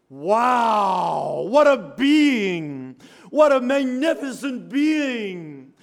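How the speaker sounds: loud, awestruck preaching cry; awed exclamation. Acted. Preacher marvelling at the vision of Jesus, loud awe.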